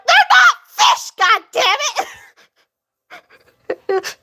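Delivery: hammed-up outrage, throwing it at the screen; shouted mock tantrum; mock furious shout. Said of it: "And I'm like".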